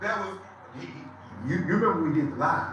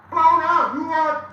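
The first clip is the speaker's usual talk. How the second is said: much louder and higher; loud challenge; 9 semitones higher, 10 dB louder than usual. Real, not acted. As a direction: bark the dare. Shouting challenge at rival.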